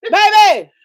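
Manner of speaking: high, loud call to a partner; acted calling out. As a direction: call out in mock alarm.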